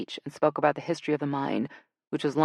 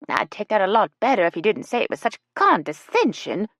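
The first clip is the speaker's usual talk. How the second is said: huffy; 6 semitones higher, 5 dB louder than usual. A narrator's reading. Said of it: "Ella huffed".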